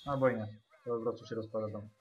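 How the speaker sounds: ordinary talk, nothing marked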